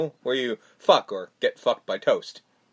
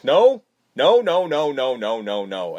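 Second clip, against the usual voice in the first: mock protest; 2 semitones lower, 5 dB louder than usual. Husband recounting the attempted kiss, mock protest falling away. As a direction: start in alarm, tumble down in pitch.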